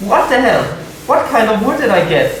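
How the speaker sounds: high, loud stunned outburst; amazed disbelief. Real, not acted. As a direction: gasp it out in disbelief. Stunned by premium wood.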